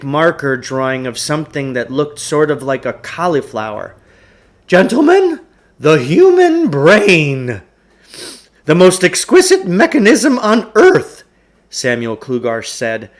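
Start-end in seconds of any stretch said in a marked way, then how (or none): 4.7-11.2 much higher and louder, booming showman's pitch; grandiose huckster. Acted. Huckster opening his lecture to two boys, grandly booming. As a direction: oversell every word like a snake-oil salesman.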